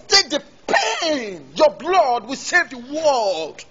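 drawn out, intoning slowly; slow, solemn. Shown as a demonstration. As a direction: show the gravity of the line slowly. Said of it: "director demonstrating delivery".

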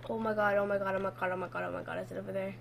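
low, soft, run-together muttering; quiet frightened chant, fading; hushed panic. Real, not acted. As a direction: repeat it under the breath, afraid to be heard. Fearful hushed panic in game.

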